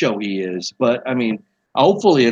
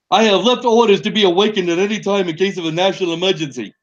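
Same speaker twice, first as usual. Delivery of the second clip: higher, bad Donald Trump impersonation; Trump impression; 5 semitones higher, faster than usual. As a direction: read it in a hammed-up Trump voice. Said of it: "That's my Trump impersonation".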